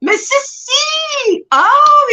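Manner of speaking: high, long squeal of welcome; excited greeting. Real, not acted. Streamer spots Missus C in chat, excited squeal.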